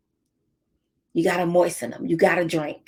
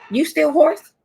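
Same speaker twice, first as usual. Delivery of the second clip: nurse's question; 7 semitones higher than usual. Retold or imitated. Nurse voiced in story.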